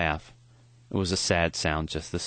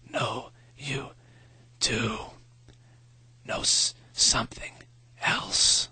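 thin whispered rasp, short bursts; a dying professor's raspy whisper; 4 semitones higher, slower than usual. A narrator's reading. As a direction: breathe the words out like a dying man. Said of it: "His voice was thin and raspy".

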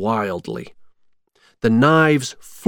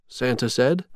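plain reading voice

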